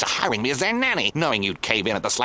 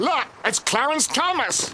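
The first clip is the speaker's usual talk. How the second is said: high slow exclamation; excited shout; 12 semitones higher than usual. Acted. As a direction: call it out in surprise. Sudden alarmed call.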